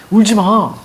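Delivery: telling them off, lightly acted; night guard's command. Night guard hushing crying recruits, mildly insistent. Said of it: "불침번이".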